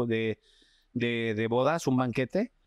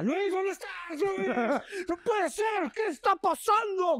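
much higher, frantic mock yell; imitating a yelling director. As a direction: call out for Luis in a panic, high-pitched. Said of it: "gritaba Eric". (imitando a Eric gritando desesperado, muy agudo)

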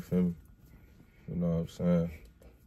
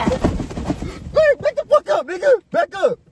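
shouting in disgust; much slower than usual. Real, not acted. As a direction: yell at him to back off. Recoiling from a bad smell.

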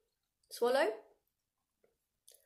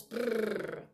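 loose lips trilling brrr; lip roll. Shown as a demonstration. Teacher demos a horse-like lip roll. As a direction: flutter your lips like a horse. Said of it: "make your lips kind of loose and they kind of brrr".

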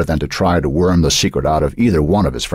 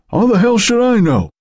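high-pitched, exasperated comeback; exasperated retort. A narrator's reading. Eric to a nosy teammate in the huddle, exasperated. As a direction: throw it back, high and irritated.

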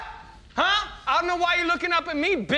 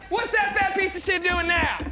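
ordinary delivery

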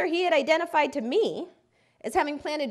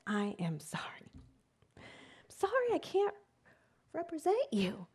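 quiet apology; 4 semitones higher, 7 dB quieter than usual. Retold or imitated. Lawyer refusing, whispered apology.

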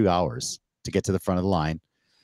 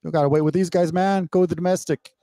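higher-pitched, easygoing officer voice; imitating a relaxed, friendly border agent, pitched up; friendly customs officer; 9 semitones higher than usual. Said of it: "And it's like, boom".

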